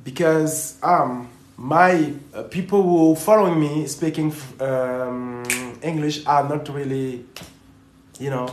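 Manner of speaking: French-accented English; halting English with a French accent, searching for words. Real, not acted. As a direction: speak English in a francophone accent, with hesitations. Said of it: "j'aime bien ton accent".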